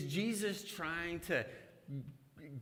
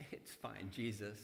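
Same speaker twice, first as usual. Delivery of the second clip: lower, softer offhand brush-off; dismissive; 5 semitones lower, 4 dB quieter than usual. Retold or imitated. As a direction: shrug Jesus off casually. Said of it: "we are like".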